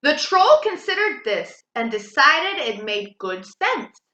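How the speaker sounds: ordinary delivery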